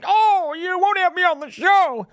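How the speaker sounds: high, wheedling mock complaint; squeaky mocking plea; mock whiny victim. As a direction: play him as a whining martyr. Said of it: "going on his show and going".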